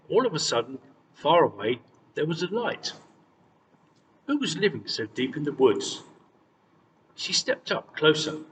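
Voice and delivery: plain reading voice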